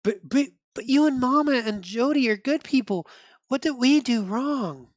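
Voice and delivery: put-on high, stammering young boy voice; pleading boy. Boy asking his father what they did wrong, high kid voice.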